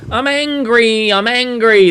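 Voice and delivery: high, drawn-out mock cry; impression of an angry comic. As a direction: whine it out long and high. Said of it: "he kept on going".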